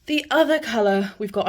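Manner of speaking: breathless after rushing about; out of breath. Real, not acted. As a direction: talk while catching your breath. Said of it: "I'm so out of breath".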